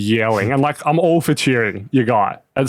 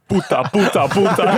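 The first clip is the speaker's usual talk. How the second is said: mock Spanish heckling; 7 semitones higher than usual. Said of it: "in Spanish".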